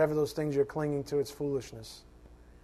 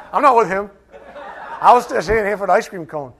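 louder, laughing mock bystander; laughing denial; 4 semitones higher, 11 dB louder than usual. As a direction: play the embarrassed stranger, laughing. Pretends to be a bystander.

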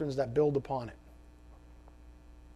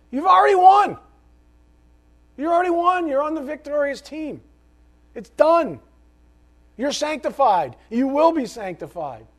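much higher and louder triumphant preaching; impassioned preaching; 10 semitones higher, 7 dB louder than usual. Acted. Preacher to congregation, triumphant full-force proclamation. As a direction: cry out the victory to the crowd.

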